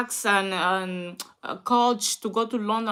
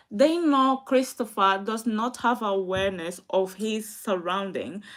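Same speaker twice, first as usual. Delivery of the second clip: ordinary talk, nothing marked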